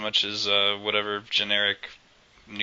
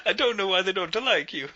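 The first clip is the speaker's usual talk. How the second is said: mock lament; 10 semitones higher than usual. Lamenting the game like Miyamoto.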